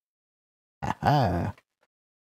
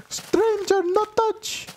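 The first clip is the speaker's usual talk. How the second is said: very high, broken-English squeak; squeaky villager voice; slower than usual. Retold or imitated. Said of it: "He straight up just walked to me".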